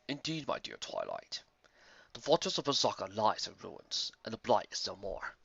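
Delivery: put-on the old sage, grave and calm; low solemn elder; deep old wizard. Starswirl's deep voice.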